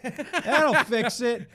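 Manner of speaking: high, loud, slow laughing voice; laughing high stoner voice. Retold or imitated. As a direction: cackle it out like a giddy stoner. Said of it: "I'm like".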